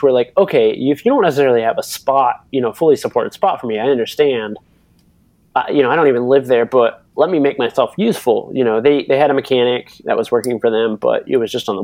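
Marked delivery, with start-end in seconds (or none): none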